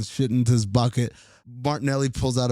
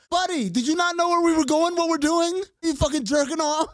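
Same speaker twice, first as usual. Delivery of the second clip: mock yell at sailor; 13 semitones higher than usual. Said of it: "I'm like".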